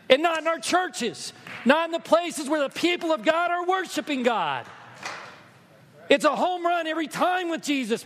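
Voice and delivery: loud, high, fervent preaching; impassioned sermon cry; preaching at full force. Acted. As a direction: build it to a passionate climax.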